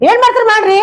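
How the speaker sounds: high-pitched angry dare; heated, high-voiced defiance; defiant retort. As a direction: fling back a challenge. Wife defies husband in quarrel.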